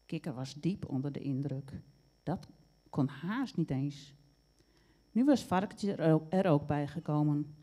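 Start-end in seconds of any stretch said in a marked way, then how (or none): none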